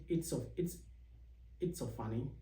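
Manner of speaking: normal talking voice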